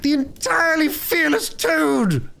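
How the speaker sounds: slow, high, swaggering proclamation; boastful Toad. A narrator's reading. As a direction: crow your own glory grandly. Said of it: "he shouted recklessly".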